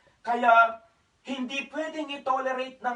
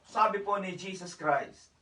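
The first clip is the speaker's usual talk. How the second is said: ordinary talk, nothing marked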